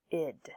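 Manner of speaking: "id" with the short i of pin. Shown as a demonstration. Teacher models the ending "id" for students, short i as in pin. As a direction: isolate "id", short vowel then D. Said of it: "this id is pronounced like silver pin".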